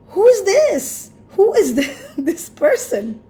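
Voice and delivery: high, loud amazed question with a laugh. Retold or imitated. Recalling meeting her new self, laughing amazed. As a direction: marvel at this stranger who is yourself.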